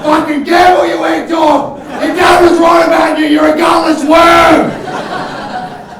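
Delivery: high, loud hammed-up abuse, last words stretched out; blokey bullying shout. Mock Aussie ad bloke berating a mate who won't gamble, high shout. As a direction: escalate the mock advert into a full yell. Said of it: "make it seem really Aussie blokey".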